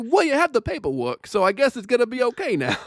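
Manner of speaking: imitating the dad accepting the paperwork; mock resigned dad. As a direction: shrug it off in a silly high voice. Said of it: "he's like".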